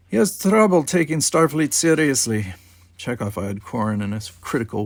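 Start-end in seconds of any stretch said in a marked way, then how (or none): 0.1-2.5 put-on Russian accent. A narrator's reading. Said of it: "His thick Russian accent".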